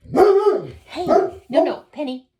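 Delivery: loud, high startled "hey, no"; sharp call to the dog. Real, not acted. Reader shouting at her dog Penny, loud and high. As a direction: stop the dog right now, loud and high.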